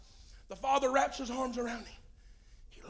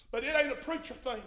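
ordinary talk, nothing marked

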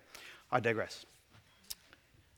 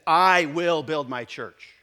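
slow, louder "I will build my church" with the "I" hit hardest; emphatic declaration; 6 dB louder, slower than usual. Shown as a demonstration. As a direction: declare it slowly, leaning on "I". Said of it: "It's very emphatic".

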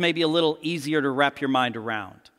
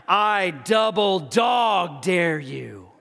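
slow, drawn-out dramatic challenge; dramatic dare; much slower than usual. Acted. Kid in the movie daring his friend, slow and dramatic. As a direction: stretch out each word of the dare. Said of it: "Some of you in here remember the line".

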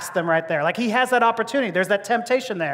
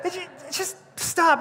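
exasperated plea; 8 semitones higher than usual. Acted. Mock pleading to a gross kid.

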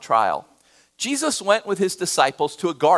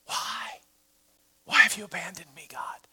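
anguished whisper; 4 semitones higher, 8 dB quieter than usual. Retold or imitated. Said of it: "What does Jesus yell as he dies".

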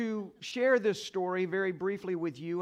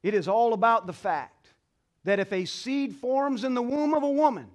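louder, higher preacher's cry; preaching at full force; 4 semitones higher, 7 dB louder than usual. Acted. Pastor urging the mothers in the congregation, preaching loudly. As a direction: lift the church with rising conviction.

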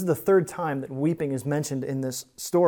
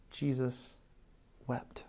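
softly, with a long pause between the words; hushed reverence; 2 semitones lower, 11 dB quieter, slower than usual. Acted. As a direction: drop to a near hush, slow and reverent. Solemn pause on Jesus wept.

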